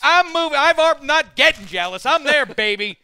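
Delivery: high, loud, flustered denial; defensive protest. Real, not acted. Host denying that he is getting jealous, loudly defensive. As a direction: protest too much, breaking into a laugh.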